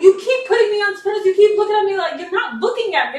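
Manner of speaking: high, agitated retold rant; pissed-off quote. Character scolding the one who keeps belittling himself, pissed off. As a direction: act out a fed-up lover's complaint. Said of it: "he got pissed off".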